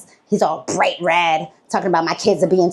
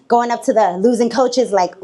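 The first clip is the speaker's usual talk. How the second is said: usual speaking voice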